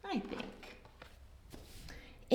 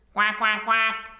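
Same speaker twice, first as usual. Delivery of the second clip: duck quacking; 3 semitones lower than usual. Acted. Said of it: "said Duck".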